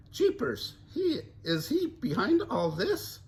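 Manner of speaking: high surprised Daphne; suspicious gasp. Acted. Daphne voiced.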